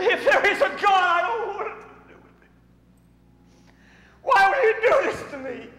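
high, loud cry at God; anguished shout. Retold or imitated. Grieving father at his son's grave, anguished shout. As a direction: hurl it at the sky in grief.

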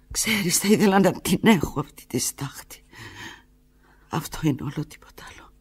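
high, hushed, fading whisper. Acted. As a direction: let the plea die to a breath. Tearful plea whispered.

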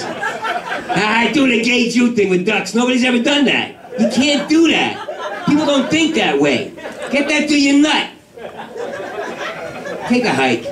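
high, slow sneering voice; mock the joke in a shrill put-on tone. Comedian mocking his own joke, high and slow.

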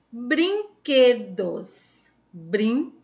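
brinquedos in slow, separated syllables. Shown as a demonstration. Teacher asking pupils which part of brinquedos is strongest, syllable by syllable. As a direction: sound out brinquedos piece by piece, drawn out. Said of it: "Qual é a mais forte?".

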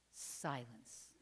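ordinary delivery

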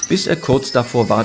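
usual speaking voice

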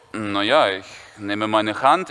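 deadpan low as Viktor; slow low deadpan boy; Viktor's voice. Retold or imitated.

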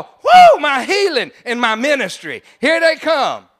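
high loud whoa, then eager; excited exclamation. Retold or imitated. The boy seeing his healers walk in, a high loud joyful whoa. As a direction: voice the boy's joy at seeing them arrive. Voicing the boy's delighted vision.

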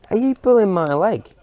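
put-on a young farmer caught off guard, half laughing; stunned disbelief. Young Jack, stunned by the old man's reply, high incredulous question. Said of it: "asked Jack, slightly stunned".

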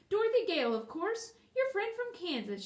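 high, loud answer; calling out. A narrator's reading. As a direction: call your name down eagerly. Answers loudly from the tower.

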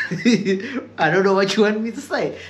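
high, slow laughing exasperation. Real, not acted. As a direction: laugh through the disbelief.

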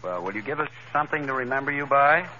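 ordinary delivery

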